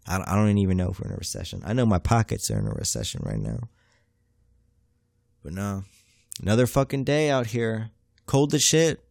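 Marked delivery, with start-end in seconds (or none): none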